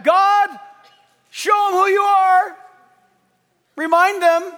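high, full-voiced prophet's cry; shouted plea to God. Elijah calling down fire from God, impassioned shout. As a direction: call out to heaven at full force.

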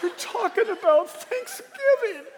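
high, slow, hammed-up awe; mock emotional churchgoer. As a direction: gush over a plain announcement. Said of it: "he's like".

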